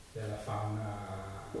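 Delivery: ordinary talk, nothing marked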